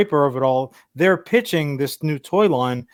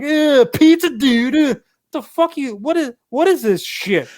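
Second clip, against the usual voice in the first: goofy turtle voice; 8 semitones higher than usual. Retold or imitated. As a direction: ham up a stoner cartoon turtle. Mocking cartoon turtle surfer voice.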